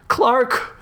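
high-pitched urgent call; acted frantic cry, pitched high; frantic shout. A narrator's reading. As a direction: call the name as if from outside. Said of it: "came a frantic shout from outside".

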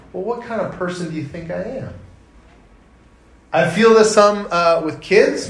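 normal talking voice

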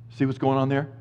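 normal talking voice

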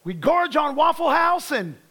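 full-force revival preaching; fiery preaching. Acted. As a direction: preach it hard to the congregation.

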